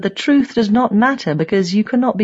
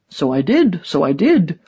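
lightly voiced old die-hard; a hint of an old man's character voice; old true believer. A narrator's reading. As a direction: answer as a proud old propagandist.

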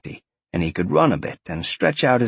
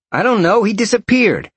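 higher-pitched, agitated man; defensive henchman; 11 semitones higher, 4 dB louder than usual. A narrator's reading. Tracker reporting the kid disappeared, high and defensive. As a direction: answer high and flustered. Second voice, flustered answer.

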